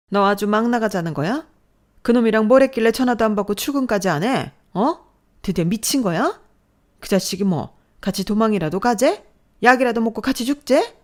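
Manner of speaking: mother screaming at her daughter; furious scolding. Acted. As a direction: tear into the daughter. Mother scolds daughter after slap.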